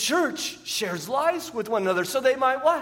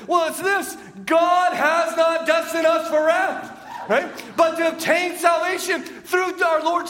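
higher, louder and slow on the key line; telling spouse the good news, higher, louder and slow; triumphant reveal; 8 semitones higher, 3 dB louder than usual. Pretend. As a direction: announce it like the best news ever.

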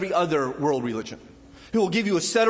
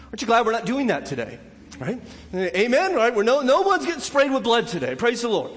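rising preaching fervor; 8 semitones higher, 4 dB louder than usual. Acted. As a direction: pitch climbing, louder joyful preaching. Preacher rises to full force.

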